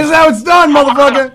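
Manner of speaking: loud, high, hammed-up battle yell; mock attack cry. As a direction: pounce on him with a pretend war cry. Acting out pouncing on the clerk.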